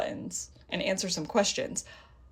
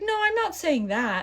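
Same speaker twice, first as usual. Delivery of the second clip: higher, mock-polite agent voice; mimicking the agent; 9 semitones higher than usual. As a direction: put on the saleswoman's higher tone. Agent voiced in retold call.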